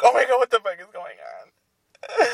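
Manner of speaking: high, squealed nightmare panic; mock panic. Podcaster acting out waking from a nightmare, mock panic. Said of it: "scary moments, and you're like".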